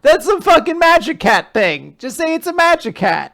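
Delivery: indignant mock protest; mock outrage. Real, not acted.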